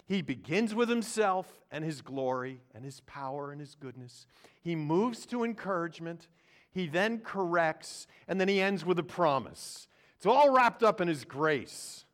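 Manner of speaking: high, loud preaching climax; full-force preaching. Acted. Preacher proclaiming the risen King loudly. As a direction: lift the church with it.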